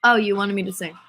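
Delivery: ordinary delivery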